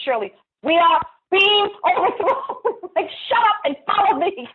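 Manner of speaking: high, laughing through the words; laughing exasperated retelling. Retold or imitated. [name] retelling urging Shirley to follow her, laughing high. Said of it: "And I said".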